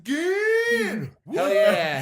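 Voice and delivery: Michigan shouted very high and long; hype shout. Real, not acted. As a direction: cheer out your home state. Hyping his home state.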